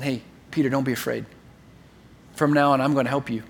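soft, low reassurance; calming and loving. Acted. Jesus with a hand on Peter's shoulder, calming. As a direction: soothe a frightened friend gently. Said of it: "in a very calming and loving way".

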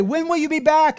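high, loud call after someone walking away; yelling after him. Retold or imitated. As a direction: shout it after the departing groom. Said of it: "she yells to him".

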